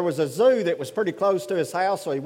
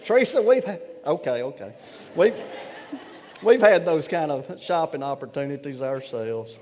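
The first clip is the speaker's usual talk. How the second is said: ordinary delivery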